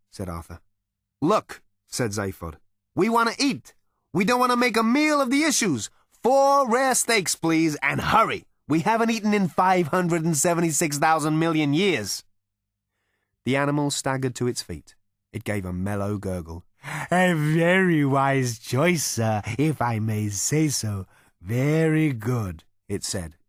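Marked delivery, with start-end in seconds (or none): 1.2-12.2 much higher, louder, impatient brash voice; a hungry alien demanding food; Zaphod character. A narrator's reading. Zaphod ordering steaks from the cow, in a much higher, louder impatient voice. 17.1-22.6 higher, very slow, gracious cow voice; a cow bowing to a good order; cow character. A narrator's reading. Cow approving Zaphod's order, in a higher, very slow gracious voice.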